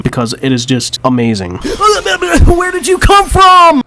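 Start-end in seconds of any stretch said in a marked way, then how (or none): 1.6-2.4 sudden startled gasp, high-pitched; sharp gasp. Real, not acted. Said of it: "how many times I fucking raged". 2.5-3.8 high, slow shriek of shock; startled shout. Real, not acted. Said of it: "how many times I fucking raged".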